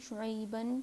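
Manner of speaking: شعیبن said shu'ayban; "an" ending. Shown as a demonstration. Teacher listing three endings, شعیبن ending in "an". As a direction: end شعیبن in "ban". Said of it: "تینوں sounds آ رہی ہے".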